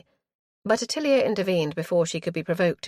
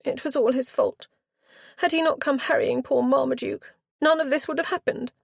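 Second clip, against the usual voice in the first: high, sulky whine; sulky girl; 9 semitones higher than usual. A narrator's reading. Girl blaming Pedwardine for everything, sulky. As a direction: pout the blame out. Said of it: "Richenda's tone became sulky".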